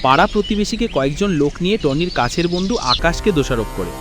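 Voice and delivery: ordinary delivery, nothing marked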